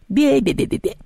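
high rapid mocking stutter; childish nyah-nyah bleating; teasing bleat. A narrator's reading. Mermaid dancing just out of paw's reach, teasing bleat. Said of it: "начала дразниться она".

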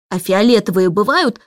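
plain reading voice

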